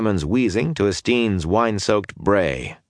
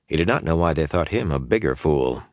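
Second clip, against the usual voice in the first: plain reading voice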